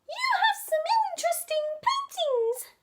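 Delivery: high, loud little egg voice; a high piping shout across the house. Acted. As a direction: call out brightly up high as Egg. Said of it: "shouted Egg".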